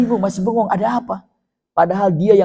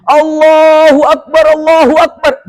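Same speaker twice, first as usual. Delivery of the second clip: slow, loud, raised chant of praise; companions' takbir; 7 semitones higher, 7 dB louder, slower than usual. A narrator's reading. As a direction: join the joyful cry. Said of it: "Beberapa sahabat ikut takbir".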